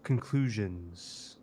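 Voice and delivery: "conclusions" slowed, ending on a long hiss; drawn-out S. Retold or imitated. Listener copies the lawyer's S's, "conclusions" with a long final S. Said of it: "you do your S's really good".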